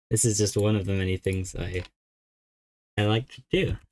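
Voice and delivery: talking around a pen; words muffled by a pen; pen in mouth. Real, not acted. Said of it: "Sorry, I have my pen in my mouth".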